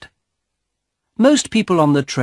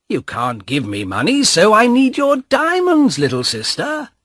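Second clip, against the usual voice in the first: lightly voiced young man taunting his sister; mocking brother; 7 semitones higher, 4 dB louder than usual.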